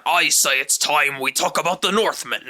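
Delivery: booming mock Viking declaring it's time. Shown as a demonstration. Host switching into Viking voice to start the movie talk. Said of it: "if I put my Viking voice on".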